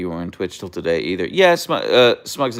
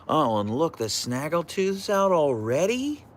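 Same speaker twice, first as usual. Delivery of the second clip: playful high voice; 9 semitones higher than usual. Real, not acted. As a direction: tease the dog fondly. Amused high voice to dog.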